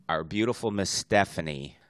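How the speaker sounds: ordinary talk, nothing marked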